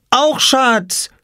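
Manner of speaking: put-on very high toddler cry; calling out. A narrator's reading. Little Lars runs after Papa and Oskar, calling out. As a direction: shout it out in a little child's high voice. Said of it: "rief er".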